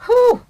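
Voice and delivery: sharp high loud exhale, whew; big whew. Real, not acted. Reacting to how crafty the powers are, loud high whew. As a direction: blow it out high and loud like you can't believe it. Exclamation after rant.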